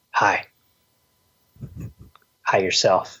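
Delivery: low, deadpan movie-character voice; movie greeting bit. Acted. Joking deathbed greeting to someone dying, low mock-movie voice. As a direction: drop low for a corny greeting. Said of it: "just go up to him and go".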